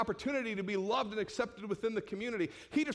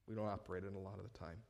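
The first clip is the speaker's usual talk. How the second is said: quiet aside; 10 semitones lower, 14 dB quieter, faster than usual. Shown as a demonstration. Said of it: "but we don't operate in a lot of the time".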